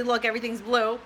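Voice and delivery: ordinary talk, nothing marked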